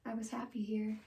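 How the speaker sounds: soft, halting stage farewell; dramatic monologue. Acted. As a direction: take leave quietly, with long silences. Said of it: "I'll be doing a monologue".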